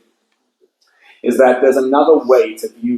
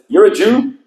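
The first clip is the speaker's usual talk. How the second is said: higher, sneering interrogation; mock terrorist; 10 semitones higher, 3 dB louder than usual. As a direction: mimic the terrorist's pointed question. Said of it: "and he says".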